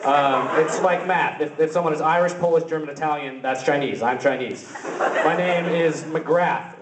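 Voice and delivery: ordinary delivery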